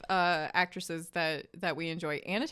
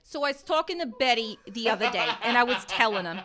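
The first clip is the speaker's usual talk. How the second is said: put-on New York accent; 4 semitones higher, 5 dB louder than usual. New York mom bit.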